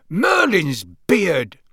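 slow, stretched-out amazed cry; astonished exclamation. A narrator's reading. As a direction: exclaim in wide-eyed amazement. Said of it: "his eyes widening".

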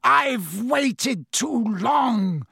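high, drawn-out furious shout; escaped prisoner shouts while choking the boy; slow desperate shout. A narrator's reading. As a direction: stretch every word out as a long-awaited scream.

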